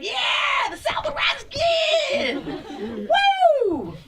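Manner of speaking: put-on high, slow gleeful kid cheering; excited kid cheer. Kid cheering the slogan, high and excited. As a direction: cheer it like a clueless eager kid. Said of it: "You're just like".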